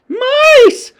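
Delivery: high, loud shriek; shout. A narrator's reading. Witch audience spotting mice, loud shout. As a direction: shriek the word out. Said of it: "shouted the audience".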